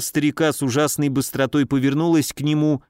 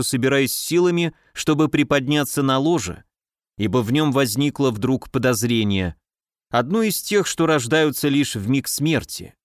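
ordinary delivery

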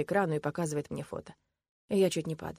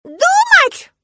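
extremely high, loud shriek of disbelief; child's outraged scream; 18 dB louder than usual. A narrator's reading. Girl appalled that father must think, screaming. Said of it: "Валя так кричит".

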